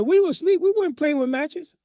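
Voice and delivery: high-pitched, indignant denial; protesting children. Retold or imitated. Children denying blame to their father, high protesting. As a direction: imitate kids pleading their innocence.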